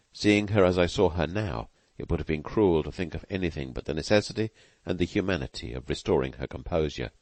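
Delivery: usual speaking voice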